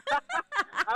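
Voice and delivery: high-pitched burst of laughter; real amused giggle; short laugh. Real, not acted. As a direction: break into a brief high laugh. Laughs during teasing chat.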